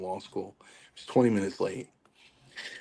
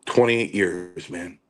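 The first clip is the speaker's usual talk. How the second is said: plain reading voice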